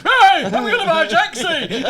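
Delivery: loud, high, laughing northern De Niro impression; laughing mock northern shout. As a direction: belt it out in a broad northern accent, laughing. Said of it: "he just does this northern voice".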